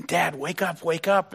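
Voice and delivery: a hint of a kid shaking dad awake; mild eager child's call; urgent little boy. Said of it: "started shaking his dad and saying".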